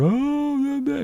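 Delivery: high mocking whine imitating Tater's sad act; mock crying Tater. YouTuber mocking Tater's fake crying, high whiny imitation. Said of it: "he's crying and doing his acting".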